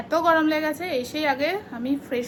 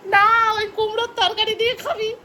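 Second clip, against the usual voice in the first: higher, louder mock bossy sister; imitating sister; 9 semitones higher, 8 dB louder than usual. Teasing viewers by mimicking sister's bossy breakfast order. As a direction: mimic her insisting on the pumpkin curry. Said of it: "ও বলছে".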